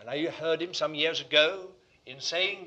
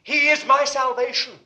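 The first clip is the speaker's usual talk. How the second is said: loud, raised, ringing proclamation; confident declaration; 8 semitones higher, 13 dB louder than usual. Acted. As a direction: proclaim it with full conviction.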